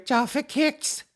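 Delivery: put-on very high, loud, slow child voice; hammed-up little kid shopping order; eight-year-old child. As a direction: drag out each item like a demanding child. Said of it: "An alcoholic eight-year-old?".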